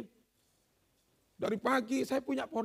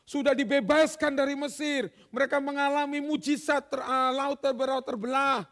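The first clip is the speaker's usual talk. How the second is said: louder, building preaching; 3 semitones higher, 6 dB louder than usual. Acted. Preacher stirring the church about the parted sea, louder. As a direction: press the miracle home.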